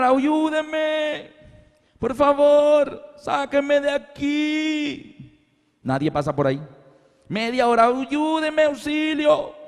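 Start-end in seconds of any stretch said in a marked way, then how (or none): 0.0-5.5 high, drawn-out call from a pit; mock cry for help. Man fallen in a hole begging passers-by, acted calling out. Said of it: "Y comienzo a gritar". 7.9-9.6 high-pitched, stretched plea for rescue; mock cry for help. Trapped man still calling after half an hour, mock shouting. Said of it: "después de tanto gritar y gritar auxilio".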